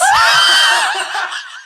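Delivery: very high, loud burst of laughter; shrieking laugh. Real, not acted. Laughing at splash box.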